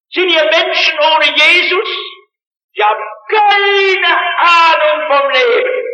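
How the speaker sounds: high, loud revival preaching, pressing hard; fiery pulpit appeal, rising and pressing; preaching at full force. Acted. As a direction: call it out to the whole hall like a revival preacher. Preacher calls out to congregation.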